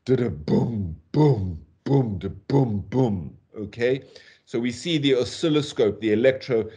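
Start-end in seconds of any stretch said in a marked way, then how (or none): 0.0-3.4 slow, heavy "boom"s with gaps between; quick "da-da", then weighty, separated falling "boom"s; catastrophic stress. Shown as a demonstration. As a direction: pound each "boom" like an irregular heartbeat. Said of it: "under this great catastrophic stress".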